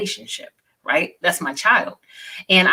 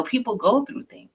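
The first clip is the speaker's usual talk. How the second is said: misty eyed; 6 semitones higher than usual. Real, not acted. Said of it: "you over there getting misty eyed".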